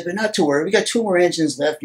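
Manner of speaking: upbeat cockpit announcement; breezy reassurance. Acted. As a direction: brush the trouble off for the passengers. Pilot reassures passengers after engine loss.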